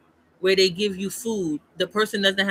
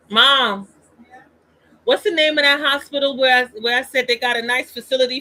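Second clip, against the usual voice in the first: louder, higher call across the house; 4 semitones higher, 6 dB louder than usual. Real, not acted. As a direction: shout to mom in another room. Calling to mom off-mic.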